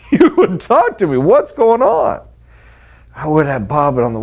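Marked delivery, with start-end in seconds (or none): 0.0-2.2 much higher, laughing mock whine; mock hurt plea. Young salesman pleading with the family who left, mock-hurt whine. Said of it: "man, I just cried out".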